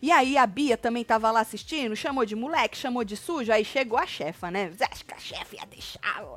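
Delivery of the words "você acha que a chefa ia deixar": whispered conspiratorial aside; stage whisper. Retold or imitated. Asking if the boss would let it pass, whispered. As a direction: whisper it as a teasing question. Aside voiced in retold story.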